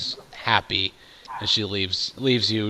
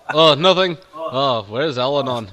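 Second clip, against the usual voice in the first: flustered; 4 semitones higher, 7 dB louder than usual. Acted. Caught out, covering quickly.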